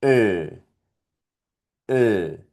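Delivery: "E" said with lips rounded as for O; German ö sound, held briefly. Shown as a demonstration. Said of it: "forma de O y sonido de E".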